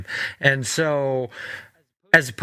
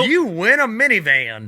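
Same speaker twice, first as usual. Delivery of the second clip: loud, high prize announcer; 7 semitones higher, 4 dB louder than usual. Pretend. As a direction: announce the prize like a game show. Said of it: "while I was in the announcer voice".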